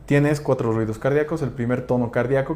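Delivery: usual speaking voice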